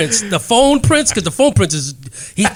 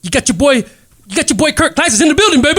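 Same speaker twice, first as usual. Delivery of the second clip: higher, fast hype intro; Kirk Classic impression; 8 semitones higher, 4 dB louder, much faster than usual. [name] introducing Kirk Classic, fast high hype intro. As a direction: hype him up quickly, pitched up. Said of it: "all these, inter-, you know, these, uh, impersonations".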